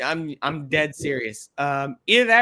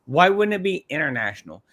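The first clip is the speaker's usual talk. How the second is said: ordinary talk, nothing marked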